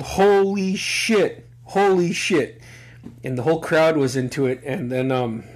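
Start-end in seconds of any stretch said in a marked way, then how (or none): 0.0-2.6 retold yell. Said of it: "And I just fucking yelled".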